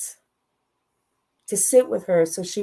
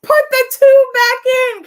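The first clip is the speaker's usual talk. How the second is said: much higher, loud mock yell; daughter's exasperated comic cry at her cursing mother; exasperated outburst; 11 dB louder than usual. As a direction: blurt it out, fed up and half laughing. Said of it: "I was like".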